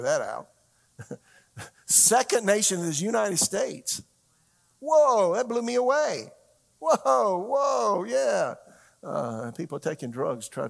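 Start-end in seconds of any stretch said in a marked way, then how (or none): 1.9-8.5 high, loud, drawn-out astonishment; amazed. Real, not acted. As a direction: let the shock burst out loud and high. Amazed reaction to a statistic.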